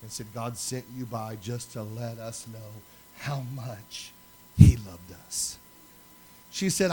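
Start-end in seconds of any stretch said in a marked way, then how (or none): 0.0-5.6 a hint of a crying woman, moved; woman thanking the pastor through tears, understated; retold weeping. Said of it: "she's just weeping profusely".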